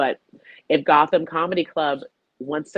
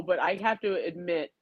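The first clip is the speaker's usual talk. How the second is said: usual speaking voice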